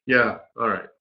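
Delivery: usual speaking voice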